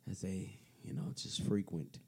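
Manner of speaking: whispered. Real, not acted. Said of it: "talking like this".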